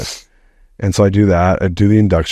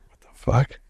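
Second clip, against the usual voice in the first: hushed startled curse; 5 semitones higher, 6 dB quieter than usual. Retold or imitated. Startled by energy in bed.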